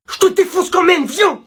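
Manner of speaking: loud, high challenge; blustering threat. Acted. Pupil threatening a teacher, loud heated bluster. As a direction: dare him to fight anyway.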